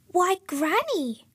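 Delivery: high, drawn-out storybook surprise; surprised cry. A narrator's reading. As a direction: stretch it out in high-pitched amazement. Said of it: "she cried in surprise".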